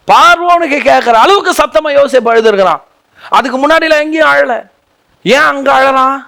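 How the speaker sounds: full-force pulpit exhortation; fiery preaching. Acted. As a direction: exhort the crowd, pitch up. Climax of Joseph weeping.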